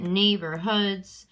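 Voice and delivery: neighborhoods drawn out, stressed on NEIGH and HOODS; slow NEIGH-bor-HOODS with a light middle syllable; two stresses. Shown as a demonstration. Teacher testing the word aloud, two stresses in neighborhoods. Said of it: "seems like there's two stresses".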